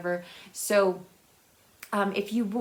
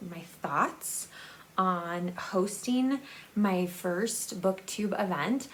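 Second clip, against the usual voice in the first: whisper; slower than usual. Real, not acted. Booktuber whispering the video title to viewers. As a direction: breathe it out like a secret. Aside about her special video.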